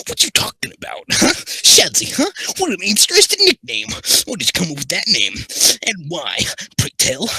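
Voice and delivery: high, loud, excitable comic voice; Eddie Murphy impression. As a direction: pitch it way up, incredulous and chatty. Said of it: "Make his voice Eddie Murphy".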